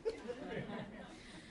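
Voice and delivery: soft airy chuckle; amused breathy chuckle after the funny reading; breathy laugh. Real, not acted. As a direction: let out a quiet breathy laugh.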